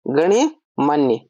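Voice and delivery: गण्यमान्य drawn out slowly, with a clear ण्य; syllable by syllable, slowly: गण्यमान्य; correct form spelled out slowly. Shown as a demonstration. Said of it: "वो होता है गण्यमान्य". (सही रूप गण्यमान्य, बहुत धीरे, ण्य साफ़ बोलते हुए)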